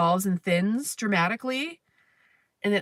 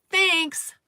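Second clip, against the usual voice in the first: mock chirpy thanks; 8 semitones higher than usual. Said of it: "they're like".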